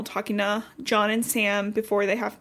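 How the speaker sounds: ordinary delivery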